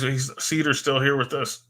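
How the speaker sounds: normal talking voice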